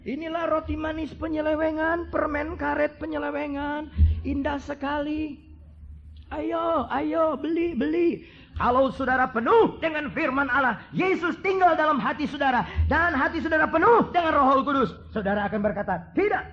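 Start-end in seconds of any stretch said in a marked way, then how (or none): 0.1-8.1 high sing-song hawker's call, slow and drawn out; devil as street vendor. Acted. Preacher voicing the devil as a street hawker, high sing-song call. 16.1-16.4 firm refusal. Acted. Filled believer refusing temptation.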